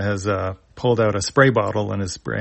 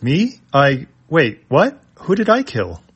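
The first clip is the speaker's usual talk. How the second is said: high, rising, startled protest; shocked disbelief; 5 semitones higher than usual. Acted. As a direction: sputter it out, caught off guard. Ruddock accused of killing.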